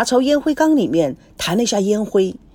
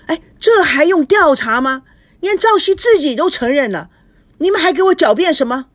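angry outburst; 7 semitones higher than usual. A narrator's reading. Angry father rejects the excuse.

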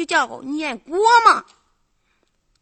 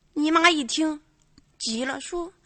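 ordinary delivery

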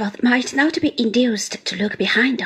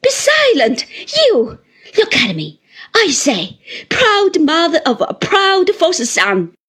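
high, loud outburst; scornful, raised-voice tirade; furious command; 6 semitones higher, 6 dB louder than usual. A narrator's reading. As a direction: silence them and press the accusation. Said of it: "turning on me with her lightning eyes".

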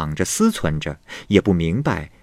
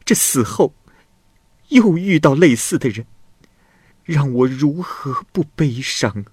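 sighing lament; 5 semitones higher than usual. A narrator's reading. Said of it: "巧娘叹气着说".

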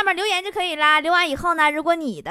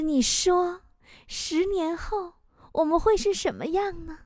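soft, slow romantic musing; mock sentimental; 3 semitones higher, 7 dB quieter, slower than usual. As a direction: ask it dreamily, softly, with long pauses. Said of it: "我突然我就煽情了". (轻声慢慢地煽情)

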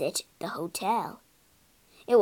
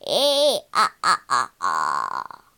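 loud wavering ghostly ah-ah; spooky ghost wail; 12 semitones lower, 6 dB louder than usual. Acted. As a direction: pop up and wail to scare him. Ghost popping from plug hole.

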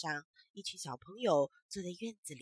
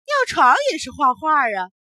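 loud teasing shout; 5 semitones higher, 17 dB louder than usual. Acted. Teasing punchline to laughter.

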